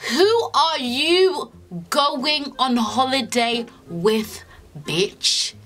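slow, drawn-out, accusing demand; exaggerated confrontational version. Shown as a demonstration. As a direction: drag it out like you're calling him out. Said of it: "I didn't say it like that".

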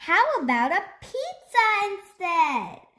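high, eager offer; excited suggestion. A narrator's reading. Owl offers the hungry dragon another meal, excited. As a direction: pitch the idea up high with enthusiasm. Owl's bright idea to the dragon.

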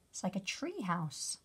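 usual speaking voice

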